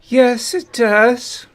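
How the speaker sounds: high, loud put-on answer; mock witness reply. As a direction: squeak out the answer in character. Answering in role in transcript.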